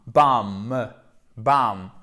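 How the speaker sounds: BAM ending on a held M, no final B; B final muet. Shown as a demonstration. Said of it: "il n'y a pas de b à la fin". (Bam sans prononcer le B final)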